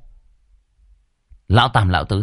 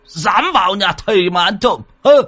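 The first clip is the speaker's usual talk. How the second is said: put-on much higher, louder blustering old man; blustering alarm; 14 semitones higher, 4 dB louder than usual. A narrator's reading. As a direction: bluster and threaten the burglar. Old man roused, hunting burglar. (ông già hùng hổ quát tháo)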